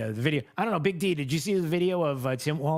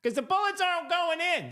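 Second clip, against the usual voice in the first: high, silly voice asking if it's a gun; mock confused gun owner. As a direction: play a baffled fool fumbling with a shotgun. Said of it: "It's like".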